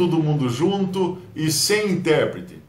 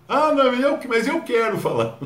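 much higher, louder childish mock protest; whiny protest; 10 semitones higher, 3 dB louder than usual. Preacher mocks a member who insists on speaking, higher whine. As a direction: whine like someone refused their turn.

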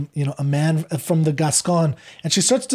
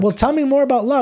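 eager Roxanne; 9 semitones higher, faster than usual. Retold or imitated. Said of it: "she's like".

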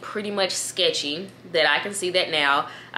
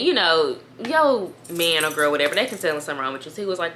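ordinary delivery

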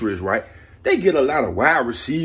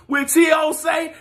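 hyped shout; 11 semitones higher than usual. Real, not acted. Hyped, asking a question.

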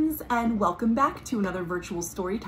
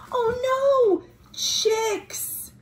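mock alarm; much slower than usual. Chicks block the path.

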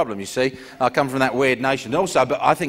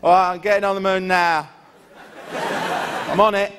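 offhand, underwhelmed bloke; a casual Englishman shrugging it off; mild mock Englishman voice; Englishman on the moon; 6 semitones higher, slower than usual. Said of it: "he would have gone".

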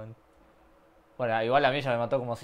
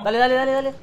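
excited shout; 12 semitones higher, 14 dB louder, much faster than usual. Real, not acted. Urging teammate on mid-game.

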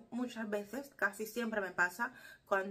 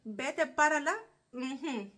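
higher, louder playful Cuban jab, cut off; cheeky Cuban exclamation; 5 semitones higher, 6 dB louder, slower than usual. Retold or imitated. Quoting Nene's first Cuban phrase to viewers, playfully loud. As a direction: blurt it out mischievously, then swallow the rest. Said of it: "primera palabra que Nene aprendió".

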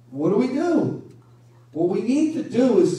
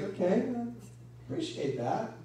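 God's voice, gentle; 9 dB quieter than usual. Retold or imitated. God answers the talkative pray-er.

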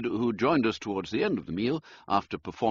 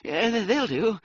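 high, stammered eagerness; flustered gentleman, keen; eager toff; 11 semitones higher, 5 dB louder than usual. Acted. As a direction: grab at the offer eagerly. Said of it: "with no little eagerness".

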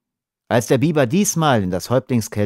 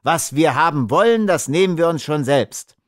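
higher-pitched, angry defiance; angry retort; 7 semitones higher, 3 dB louder than usual. A narrator's reading. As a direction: throw it back angrily. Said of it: "riefen die Männer voll Zorn".

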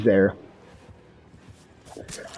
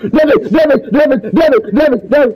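frantic yelling; 10 semitones higher, 6 dB louder, faster than usual. Real, not acted. Panicked calling teammate during chase.

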